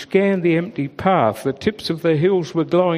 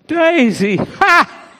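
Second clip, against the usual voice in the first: high, loud scoffing laugh at the name; mocking laugh; 12 semitones higher, 6 dB louder, slower than usual. A narrator's reading. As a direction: laugh her off, not again. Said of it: "everyone laughed".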